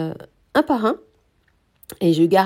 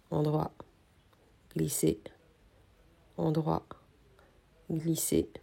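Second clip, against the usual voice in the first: murmured stitch count; 4 semitones lower, 8 dB quieter than usual. Real, not acted. Quietly counting stitches while knitting.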